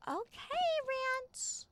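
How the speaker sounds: very high little voice; sheepish compliance. Real, not acted. Sister answering her big brother, high meek voice. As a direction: squeak out a meek agreement.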